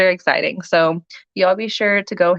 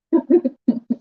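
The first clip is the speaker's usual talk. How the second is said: light happy laugh; 8 semitones higher than usual. Real, not acted. Happy about her scrunchies.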